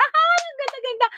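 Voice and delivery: high, gushing delight over a gift; thrilled gushing. Retold or imitated. Mother gushing over an expensive gift, thrilled and high. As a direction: gush with joy, voice jumping up.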